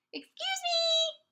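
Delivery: a tiny cricket politely interrupting. Acted. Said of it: "coughed a cricket".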